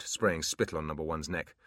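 ordinary delivery, nothing marked

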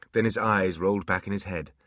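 usual speaking voice

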